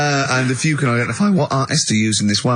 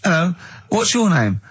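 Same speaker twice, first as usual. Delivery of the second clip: mock neighbour voice; 6 semitones higher than usual. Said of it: "didn't even do the Chinese accent".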